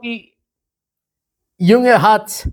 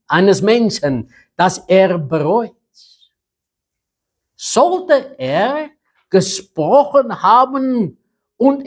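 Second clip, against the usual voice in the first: raised, deliberate preaching; scripture reading; 5 semitones higher than usual. Acted. Quotes scripture about God.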